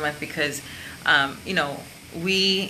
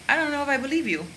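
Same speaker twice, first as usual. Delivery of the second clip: much higher, doubting voice; skeptical doubt; 10 semitones higher, faster than usual. Shown as a demonstration. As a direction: let them hear in their gut you don't buy it. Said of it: "let someone know in their gut".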